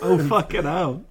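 swearing through a laugh; real amused dismay; laughing exasperation. Real, not acted. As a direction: laugh it off as you curse. Caught out, laughing.

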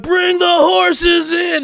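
high, slow, drawn-out mock crowd yell; party crowd shout. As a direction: chant it like a rowdy party crowd. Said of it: "people are like".

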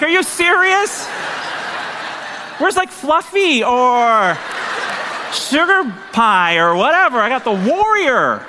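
slow, high, laughing disbelief; mock disbelief. Rider mocking the horse named Warrior, slow high disbelief.

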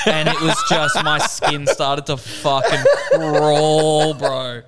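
stretched out, laughing through it; cringing laugh at the memory; laughing at his own embarrassment while retelling it; laughing embarrassed. Real, not acted. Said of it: "so awkward".

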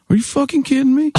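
flabbergasted. Retold or imitated. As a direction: imitate an outraged, incredulous fan. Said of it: "He was, like, flabbergasted".

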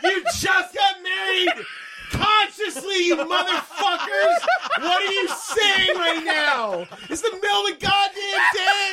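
high, loud, incredulous shouting; exasperated yell. Retold or imitated. Podcast host ranting at the movie character, loud and high. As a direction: yell in disbelief at the screen.

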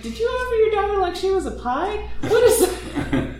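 very high squeal of laughing disbelief; laughing, high-pitched mock horror; shocked laughing question. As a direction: squeak it out, cracking up. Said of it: "And you're just like".

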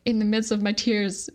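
ordinary talk

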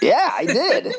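high, laughing confession; laughing admission. Real, not acted. Host caught in a name mix-up, laughing admission. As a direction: own up through a burst of laughter.

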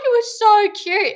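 very high, drawn-out mock sob; hammed-up weepy gushing; imitating crying. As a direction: squeal it slowly as if in tears.

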